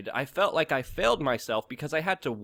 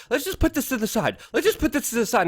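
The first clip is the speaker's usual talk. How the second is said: higher-pitched mock voice, sweeping the issue away; flustered deflection; 9 semitones higher than usual. Host sidestepping after being called out, flustered deflection. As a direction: mimic a hurried, high-pitched brush-off.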